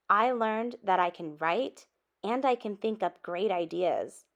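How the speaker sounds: ordinary delivery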